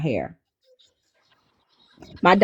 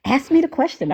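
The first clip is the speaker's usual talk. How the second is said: 1 pestering daughter; 12 semitones higher than usual. Retold or imitated. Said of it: "she kept saying".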